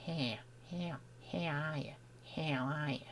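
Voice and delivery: very quiet practice of an Australian accent; tentative, soft Australian accent; attempted Australian accent. Shown as a demonstration. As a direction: test the Aussie vowels under the breath. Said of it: "the way to do that accent yet".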